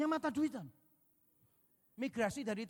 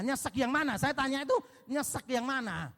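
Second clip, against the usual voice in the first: "Nyesek yang mana?" pushed out loud and higher; louder repeat; 4 semitones higher, 7 dB louder than usual. Real, not acted. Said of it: "repeated louder".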